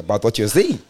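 usual speaking voice

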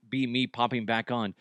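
usual speaking voice

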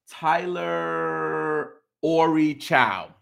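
sounding it out. Real, not acted. Reading out a hard name.

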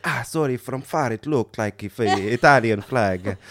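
put-on comic Italian-accented English. Italian driver apologising to a stranger, comic Italian accent. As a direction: lay on a cartoon Italian accent. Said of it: "the comically stereotypical accent".

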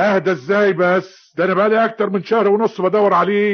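high, loud outraged shouting; furious protest. Acted. Cheated man protesting to peacemakers, furious. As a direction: burst out at being told to calm down.

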